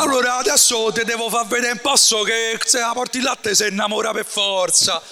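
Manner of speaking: hammed-up Roman dialect delivery; Roman accent. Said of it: "Sento l'accento romano".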